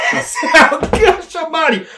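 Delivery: usual speaking voice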